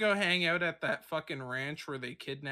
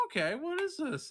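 puzzled; 10 semitones higher than usual. Retold or imitated. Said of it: "I'm like".

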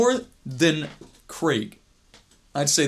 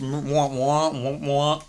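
a muffled trombone-like wah wah, lightly done; Charlie Brown adult voice; slower than usual. Retold or imitated. Friend mocks a scrawl with a Charlie Brown wah wah. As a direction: mumble it like the unseen grown-ups. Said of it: "It's like the Charlie Brown scribbles".

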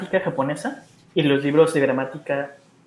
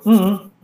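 loud, high two-beat "mm, mm" meaning no; pujido de negación; 5 semitones higher, 7 dB louder than usual. Shown as a demonstration. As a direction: grunt a two-part no. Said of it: "Eso es sí y no". (pujido fuerte y agudo de no)

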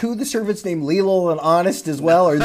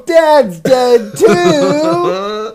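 high, loud, drawn-out hammed-up wail; mock wail; 11 semitones higher, 8 dB louder, much slower than usual. Friend mocking the tragic reveal with a drawn-out wail. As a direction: wail the news like a melodrama.

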